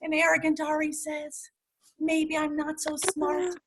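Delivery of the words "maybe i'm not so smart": slow, softer admission; a touch of sad realization; humbled. Acted. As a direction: say it slowly and quietly, humbled. Character realizes his failure.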